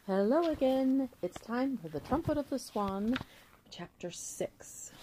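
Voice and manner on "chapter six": slow breathy whisper of 'Chapter Six'. Shown as a demonstration. Reader teasing listeners with a whispered title, slow whisper. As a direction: hush it out like a secret. Title read a second time.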